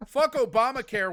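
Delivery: loud blustering politician's slogan; mock Republican politician. Mocking the Republican Party, a hint of a blustering pundit. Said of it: "Republican Party talking about healthcare".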